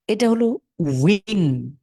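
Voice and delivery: ধীরে উইন; slow demo. Shown as a demonstration. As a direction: draw out উইন carefully. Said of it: "উইন অনেকটা আমরা যদি".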